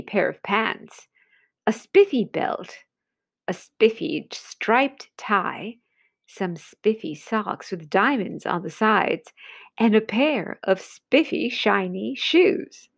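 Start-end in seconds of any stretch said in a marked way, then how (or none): none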